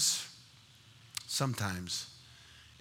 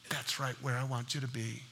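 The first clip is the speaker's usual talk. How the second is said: soft, lowered voice of Jesus; quiet reassurance; 4 semitones lower, 9 dB quieter than usual. Acted. Jesus to the one with nothing left, softly reassuring. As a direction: answer gently, well below preaching volume. Jesus answers the broken person.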